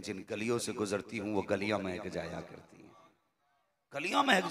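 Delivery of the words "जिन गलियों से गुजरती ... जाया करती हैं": softer, hushed repeat; soft retelling. A narrator's reading. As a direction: let the line sink in quietly. (धीमी, नरम आवाज़ में दोहराना)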